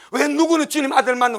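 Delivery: ordinary talk, nothing marked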